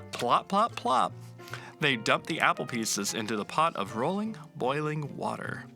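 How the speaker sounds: plain reading voice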